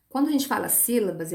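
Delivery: ordinary talk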